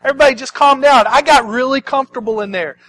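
high, loud comic complaint; Lazarus protesting. Acted. Lazarus to the cheering crowd, protesting comically. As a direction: protest like someone rudely disturbed from comfort.

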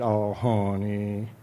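slow, drawn-out sweet-talk; coaxing wife. Acted. Eve coaxing reluctant husband Adam, slow and sweet. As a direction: stretch it out to win him over.

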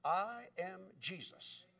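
soft, slow, weighty reveal; quiet divine answer. Retold or imitated. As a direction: answer gently, spacing each word. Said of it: "he got an answer back".

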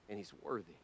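soft, hushed reverence; quiet repeat. Acted. Preacher repeating to the congregation, hushed. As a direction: let it fall softly.

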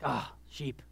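1 sigh. Acted. Jesus sighing at the sheep.

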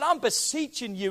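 usual speaking voice

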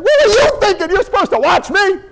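high, loud angry scolding; a furious parent dressing down a child; shrill loud "what were you thinking". Retold or imitated.

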